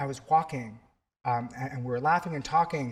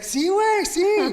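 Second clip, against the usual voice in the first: very high, louder, delighted; excited yes; 6 dB louder, slower than usual. Retold or imitated. Student confirms his name to his teacher, very high and joyful. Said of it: "And he's like".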